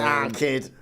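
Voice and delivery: put-on Manc accent, nasal; fake Manc accent. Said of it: "People do fake Manc accents".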